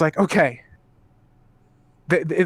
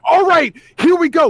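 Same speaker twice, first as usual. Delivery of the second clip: hyped; 8 semitones higher, 4 dB louder than usual. Retold or imitated. Reliving hype at fight start.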